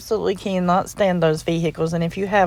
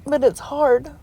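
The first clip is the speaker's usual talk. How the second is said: crying; 4 semitones higher than usual. Real, not acted. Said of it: "I don't mean to cry on you".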